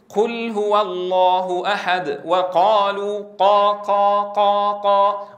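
slow recited qaf with a leak of breath on each قا; breathy wrong qaf. Shown as a demonstration. Teacher mimicking how many reciters say qaf, whispery-aspirated ق. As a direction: let air escape after every ق in قا. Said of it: "فالكثير ينطق القاف هكذا فيقول".

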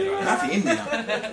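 high, laughing imitation of the doctor; doctor's surprised verdict. Retelling the doctor's check, high mock doctor voice. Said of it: "e dice".

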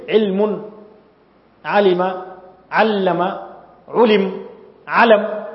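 علم said several times, a different set of short vowels each time; teacher sounds out علم with changing vowels; vowel pattern demo. Shown as a demonstration. Teacher showing students how علم changes with its vowels, slow clear demo. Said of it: "ماذا غيرنا؟".

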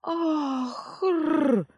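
choking rasp, high and strained; хрип и кашель. Acted. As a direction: gasp and rasp like drowning. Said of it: "хрипела". (хриплый надрывный вскрик)

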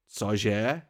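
sudden startled question, rising; blurted in surprise. A narrator's reading. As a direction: blurt it out, caught off guard. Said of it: "vyhrkl jsem". (vyhrknuté překvapením)

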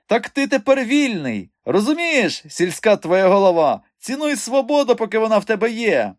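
high-pitched, gleeful gloating. A narrator's reading. Mikha telling his friend he is free now, gloating. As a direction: tease the village bumpkin at a high pitch. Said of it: "зловтішався він".

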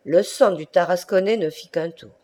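usual speaking voice

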